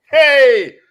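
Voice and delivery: high, loud excited call across the room; host shouting out to Haitian listeners, loud and excited. Real, not acted.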